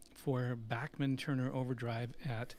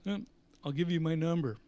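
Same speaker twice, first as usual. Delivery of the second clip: a hint of an offhand star, a little louder; rock star voice; 4 semitones higher, 5 dB louder than usual. Star answering a fan's request, lightly voiced and offhand. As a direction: humour the eager fan.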